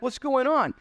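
high, baffled little question; lost priest asking what's happening; bewildered priest. Retold or imitated. Priest watching spies take everything, high and baffled.

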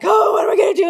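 high, loud panicked wail; mother locked out in the rain, hysterical. Retold or imitated. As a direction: wail it like everything is falling apart. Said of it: "like, hysterical that I'm like".

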